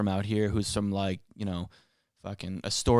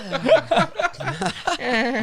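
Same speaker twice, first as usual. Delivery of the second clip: high-pitched cracking-up laugh; real giggle, high and long. Real, not acted. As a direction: break into a long squeaky giggle. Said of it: "Is your father Peter Griffin?".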